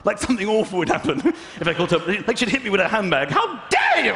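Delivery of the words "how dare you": high, mock-shocked royal rebuke; hammed-up offended old lady; outraged Queen. Imagined Queen scolding.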